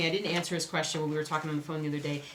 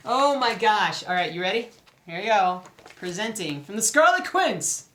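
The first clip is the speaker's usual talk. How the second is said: much louder, thrilled announcing; excited presentation; 3 semitones higher, 9 dB louder than usual. Real, not acted. Vlogger unveiling her new pattern to viewers, loud and thrilled.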